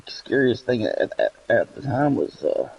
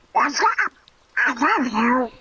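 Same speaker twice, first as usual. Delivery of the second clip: very high squeaky duck voice; ducky voice; 14 semitones higher, slower than usual. Retold or imitated. Uncle talking to his nieces in a high squeaky duck voice. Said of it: "do a du-, a ducky voice".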